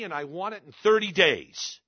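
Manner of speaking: retold widow putting her foot down; forceful demand. Widow demands money quickly.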